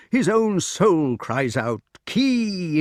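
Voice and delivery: slow, high cry of Kee; kestrel cry. A narrator's reading. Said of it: "cries out 'Kee!' in imitation".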